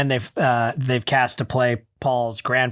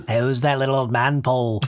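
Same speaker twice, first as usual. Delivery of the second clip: 1 [name]. Retold or imitated. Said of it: "my go-to whenever I'm doing, like, [name]".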